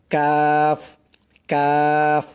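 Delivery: Arabic kaf said firm. Shown as a demonstration. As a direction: say কাফ crisp and firm. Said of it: "শক্ত করে".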